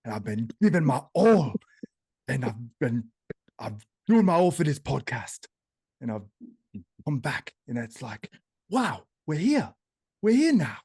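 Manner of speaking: emphatic, animated impression with rising swells; hammed-up impression of a heartfelt update; Samson. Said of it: "I can do Samson".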